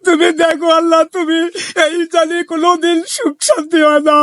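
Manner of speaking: high, tearful wailing to Allah; crying. Acted. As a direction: sob out a wounded lament. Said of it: "আপনি কান্না করছেন কেন".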